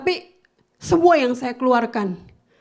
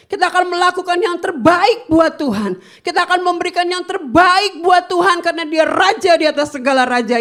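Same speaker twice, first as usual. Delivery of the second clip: loud, high, impassioned sermon; forceful preaching; 8 semitones higher, 6 dB louder than usual. Acted. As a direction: drive the point home to the church. Preaching to congregation.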